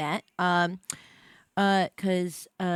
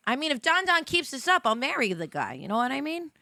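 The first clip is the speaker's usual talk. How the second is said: normal talking voice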